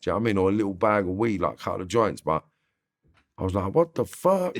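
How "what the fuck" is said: shocked disbelief. Retold or imitated. Kid seeing tiny strips, shocked high outburst. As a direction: pitch jumps high in outraged disbelief. Realising he'd been ripped off.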